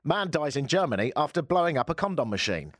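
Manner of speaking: normal talking voice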